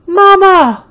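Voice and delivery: loud, high shriek. A narrator's reading. Hysterical toddler shrieking for her mama, loud and high. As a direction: scream out in panic. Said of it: "Eatha shrieked again".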